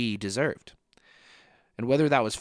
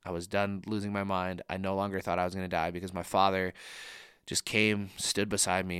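ordinary talk

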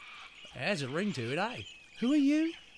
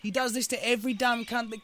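angry outburst; 5 semitones higher, 8 dB louder than usual. Acted. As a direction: slam it down hard. Wombat cuts him off angrily.

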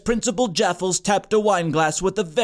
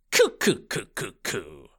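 pigeon coos; 11 semitones lower, 5 dB quieter than usual. A narrator's reading. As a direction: coo like a bird mid-speech. Principal cooing like bird.

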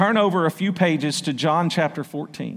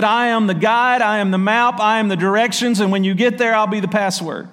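loud, higher preacher's cry on every clause; preaching at full force; 7 semitones higher, 6 dB louder than usual. Acted. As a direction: proclaim it to the whole church.

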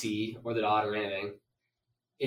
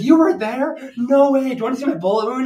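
put-on much higher, louder, thrilled girlish voice; excited young woman; 11 semitones higher, 11 dB louder than usual. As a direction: imitate the daughter bursting with excitement. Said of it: "she's like".

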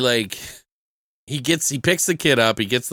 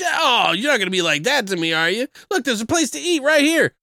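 whiny; 11 semitones higher than usual. Retold or imitated. Jackson whining about food.

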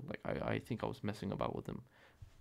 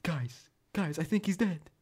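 a higher, louder call of alarm; imitating the worried friend, raised and higher; 8 semitones higher, 5 dB louder than usual. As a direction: sound the alarm, a friend in mock panic. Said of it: "he's like".

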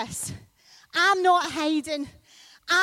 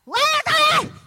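"Light our fire!" yelled much higher and louder; desperate shout; 10 dB louder than usual. Retold or imitated. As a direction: scream it at the rock, begging. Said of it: "So he shouts louder".

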